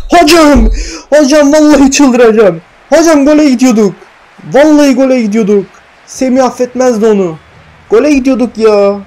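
loud, high shouting at the referee; furious protest. Real, not acted. Gamer yelling at the referee over a denied goal, outraged shout. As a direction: yell your outrage at the ref, hocam.

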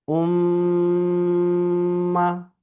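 উম্মা with a long, steady nasal hum on the mm; ghunna. Shown as a demonstration. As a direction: hold the ম্ম in উম্মা very long through the nose, on one pitch. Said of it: "গুণনার পরিমাণ এক আলিফ বরাবর". (উম্মা-র ম্ম নাকে টেনে গুন্নাহ করে)

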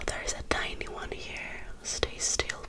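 ordinary delivery

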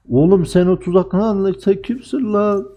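high, drawn-out mock taunt; taunting challenge. Real, not acted. Streamer taunting the enemy player, mocking challenge. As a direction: sneer it at the enemy who trapped you.

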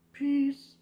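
loud, high, punchy peace-out; shouted sign-off. Real, not acted. YouTuber shouting a cheery farewell to viewers, loud and high. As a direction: fling it out to the viewers.

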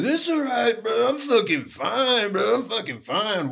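high, loud frat-bro yell; hammed-up bro shouting through injury. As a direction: imitate a drunk frat bro insisting he's okay. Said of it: "Total frat bro move".

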